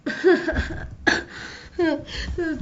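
long high laugh, mostly breath; high breathy laugh. Acted. Laughter after the scuffle.

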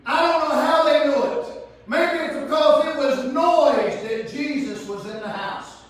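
loud fervent preaching; preaching at full force. Acted. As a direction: lift it up and drive it home. Preacher rising in pitch.

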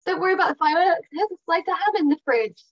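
high, bright-toned cheerful reassurance, a bit fast; upbeat reassuring. Shown as a demonstration. Owner cheering up a scared dog with upbeat chatter. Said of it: "being all upbeat and".

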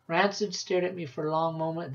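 ordinary delivery, nothing marked

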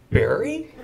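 high, questioning mock surprise; startled recognition. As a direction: act out a startled double take. Driver startled to recognize him.

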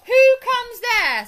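very loud, high sentry's shout; drawn-out shouted challenge; sharp challenge. A narrator's reading. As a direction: bellow it slowly, stopping an intruder. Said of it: "said the ferret sharply".

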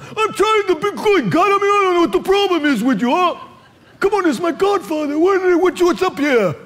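high, hammed-up Italian accent; Italian zone. Man arguing with God, exaggerated Italian accent. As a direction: plead like an agitated Italian godfather. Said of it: "I get in the Italian zone".

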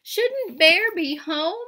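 high, loud and slow; worried cry. A narrator's reading. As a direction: fret aloud for the lost friend. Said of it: "cries Hare".

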